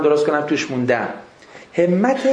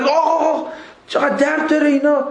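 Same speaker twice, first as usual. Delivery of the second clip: mock pained customer; 8 semitones higher than usual. Retold tattoo customer in pain.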